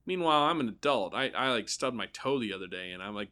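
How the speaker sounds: ordinary delivery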